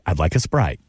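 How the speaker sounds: customer. Acted. Acting a Southern waiter exchange.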